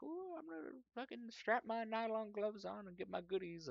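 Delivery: high-pitched gleeful squeal of a voice; giddy anticipation. Acted. Researcher imagining himself grabbing his goodies, giddy high voice. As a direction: act out giddy glee, way up high.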